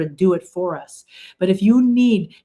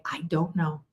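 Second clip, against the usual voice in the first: quiet admission; 3 semitones lower, 8 dB quieter than usual. Retold or imitated. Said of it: "if you say".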